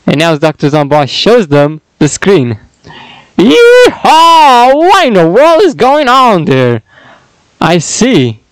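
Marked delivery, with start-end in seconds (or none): 3.4-6.8 high, loud cowboy whoop; excited whoop. Acted. Henchman cheers at the screen, loud whoop. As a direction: whoop it out like a rodeo.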